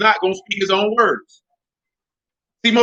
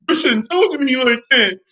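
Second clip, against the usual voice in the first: higher, hammed-up offended whine; mock feminine complaint; 5 semitones higher than usual. Mimicking man upset at being called stupid, mock feminine. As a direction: imitate a man getting his feelings hurt. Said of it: "You're acting very feminine right now".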